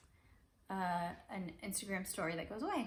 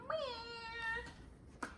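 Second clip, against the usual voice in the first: much higher, tiny kitten meow; baby star meow; 14 semitones higher, 5 dB quieter than usual. Pretend. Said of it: "this one's a baby star saying".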